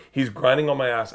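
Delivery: normal talking voice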